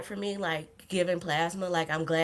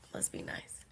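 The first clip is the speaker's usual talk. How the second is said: much softer, unvoiced whisper of "Must be nice."; whispered repeat; 14 dB quieter than usual. Real, not acted. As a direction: mutter "Must be nice." under your breath.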